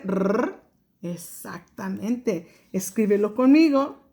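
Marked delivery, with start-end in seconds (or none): 0.0-0.6 a short rolled "Rrr"; teacher models a short rolled "Rrr"; trilled R. Shown as a demonstration. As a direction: roll the R for the kids. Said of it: "sonido de la doble R".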